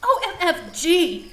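high, loud outraged yell; shocked shout. Acted. Ebony catching the professors spying, shocked shout. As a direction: blurt it out in shock. Ebony discovers teachers watching.

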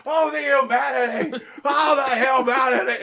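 high, loud hammed-up commentator yell, laughing through it; a laughing send-up of a ringside call; Jim Ross impression. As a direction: belt it out like a frantic wrestling announcer. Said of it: "Jim Ross is like".

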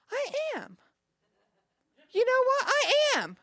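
very high, loud mimicked coworker; high-pitched excited retelling; imitated excited realization. Acts out coworker's delighted realization.

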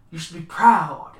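booming burst on the praise; loud proclamation. Acted. Galliard praises the newcomer, loud proclamation. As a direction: proclaim it with sudden force. Spirit speaker acclaims the player.

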